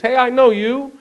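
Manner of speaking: high, loud playful mimicry of Richard Kind; a caricatured actor recognizing him, high and loud; imitating Richard Kind. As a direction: pitch it way up like the actor spotting you. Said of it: "he looked at me and goes".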